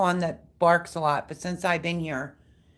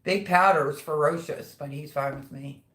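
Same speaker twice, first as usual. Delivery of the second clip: normal talking voice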